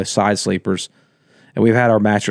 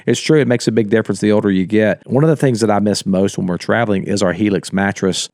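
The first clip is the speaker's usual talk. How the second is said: normal talking voice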